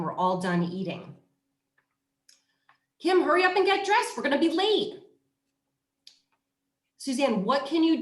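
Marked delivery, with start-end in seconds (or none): 3.0-5.0 put-on high, loud and fast, hurrying a child; rushed order. Shown as a demonstration. Parent hurrying Kim out the door, loud rushed command. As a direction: bark it out like a late parent. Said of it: "Okay, so that's the end".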